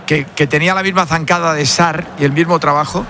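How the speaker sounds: normal talking voice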